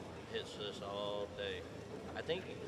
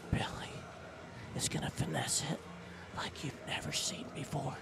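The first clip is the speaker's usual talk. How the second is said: whispered commentary; whispering; 5 semitones higher than usual. Real, not acted. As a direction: hush it like golf.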